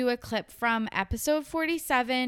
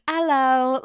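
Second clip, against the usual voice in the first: a light, half-British "Hello"; a hint of a prim British host's "Hello"; sort of British; 2 semitones higher, 4 dB louder than usual. Parisian baker greets a tacky tourist, faintly British. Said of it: "that kind of sounded British".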